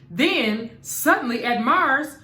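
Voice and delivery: plain reading voice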